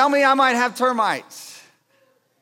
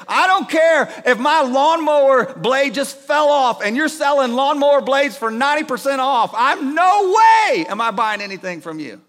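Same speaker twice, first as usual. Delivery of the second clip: exasperated rant; 4 semitones higher, 6 dB louder than usual. Retold or imitated. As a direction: bark it out like the babies just woke. Naps ruined by doorbell, fuming.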